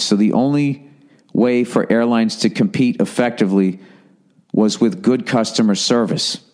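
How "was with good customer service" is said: normal talking voice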